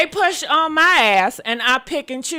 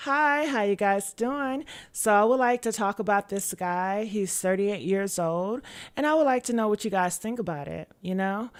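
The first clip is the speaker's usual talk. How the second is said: polite, much softer, reined-in talk; soft conservative voice; 3 semitones lower, 8 dB quieter than usual. Shown as a demonstration. Streamer imitates a conforming version of themselves, soft and polite. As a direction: play it quiet and well-behaved. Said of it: "I can talk softer. I can".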